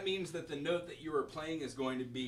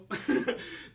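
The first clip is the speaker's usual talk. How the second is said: breathy laugh; 9 semitones higher, 5 dB louder than usual. Real, not acted. Laughs after rubato.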